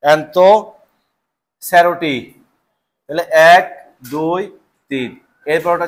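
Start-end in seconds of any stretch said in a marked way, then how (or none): 0.0-2.1 drawn out syllable by syllable; slow spelled-out term. Shown as a demonstration. Teacher drawing out a term for the class, slowly. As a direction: say অ্যান্থোসেরোটি very slowly for students.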